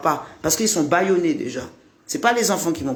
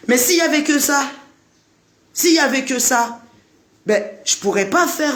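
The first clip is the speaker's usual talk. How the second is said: emphatic; 7 semitones higher than usual. Real, not acted. Emphatic point in rant.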